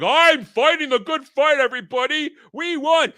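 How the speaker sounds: high, loud hammed-up proclamation; mock self-proclaimed hero. Mocking a grifter who claims the good fight, hammed-up triumph. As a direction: crow victory like a pompous crusader. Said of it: "just hovers above it like".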